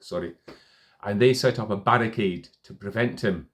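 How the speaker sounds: ordinary talk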